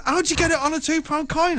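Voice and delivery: high mock-baffled voice; amazed friend. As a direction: ask it like a stunned fan of magic. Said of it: "And he went".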